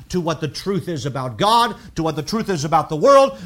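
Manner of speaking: ordinary delivery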